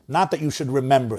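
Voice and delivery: ordinary delivery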